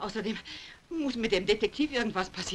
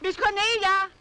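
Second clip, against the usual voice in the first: high, loud anxious call; shouting; 11 semitones higher, 5 dB louder, slower than usual. Acted. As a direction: shout her name across the house. Searching the house, calling.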